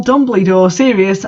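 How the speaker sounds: plain reading voice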